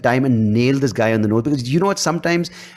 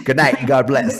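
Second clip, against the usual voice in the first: laughing mock sign-off; 3 semitones higher, slower than usual. Mocking mic drop exit.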